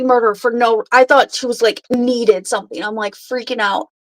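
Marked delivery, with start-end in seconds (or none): none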